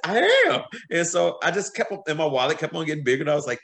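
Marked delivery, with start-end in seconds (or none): 0.1-0.6 high, loud proud answer; bright high I am; eager reply. Retold or imitated. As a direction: shoot back with glee.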